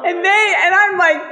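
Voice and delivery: high and loud triumph; excited. Real, not acted. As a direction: crow with delight. Triumphant retelling.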